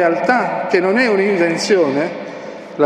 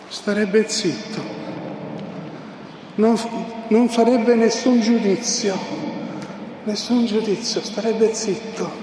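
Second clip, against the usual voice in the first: mocking hush; 4 semitones higher, 5 dB quieter than usual. Mocking the journalist's advice.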